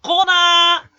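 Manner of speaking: long, loud, high title call; segment title shout. Acted. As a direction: yell it like a show's jingle, drawn out. (コーナー名を高らかに叫ぶ)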